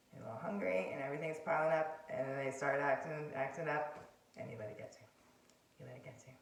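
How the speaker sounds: ordinary talk, nothing marked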